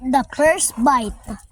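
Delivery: slow, deliberate announcing; slow announcement. Pretend. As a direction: announce it slowly. (mabagal na pag-anunsyo)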